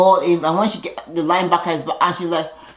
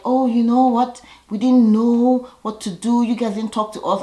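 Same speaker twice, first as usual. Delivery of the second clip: a hint of a higher, sweet-innocent voice; mock innocent girls; 6 semitones higher, 3 dB louder than usual. Girls excusing themselves to the men, mildly mock innocent. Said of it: "And the girls were like".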